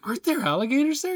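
incredulous high question. Retold or imitated. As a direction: ask it high, unable to believe it. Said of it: "I'm like".

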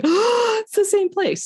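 the penny drops, high and loud; sudden realization. Retold or imitated. Realizing the friend's church is hers, high surprised gasp.

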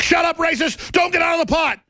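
high, shouted command in mockery; mock shouted order. Captors order patriots to stay in the pot, mock shouting. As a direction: yell it as a caricatured captor.

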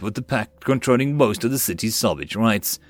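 ordinary delivery

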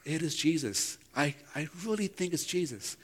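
hushed. Retold or imitated. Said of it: "coming back saying".

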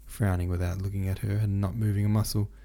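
ordinary delivery, nothing marked

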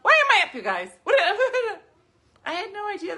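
high, loud laughing rant; giddy laugh-talk about staying up. Real, not acted. Laughing about energy drink.

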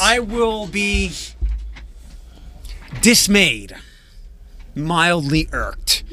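slow, drawn-out theatrical dismay; mock indignation. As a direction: overplay being offended, slowly. Mock dramatic complaint.

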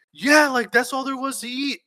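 high, loud retold self-defence; panicked excuse. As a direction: protest in a fluster.

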